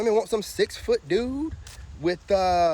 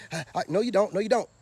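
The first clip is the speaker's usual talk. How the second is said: ordinary talk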